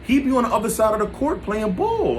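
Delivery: high-pitched excited retelling; animated friend. Retold or imitated. Friend reporting he knows the guy, imitated in high eager voice.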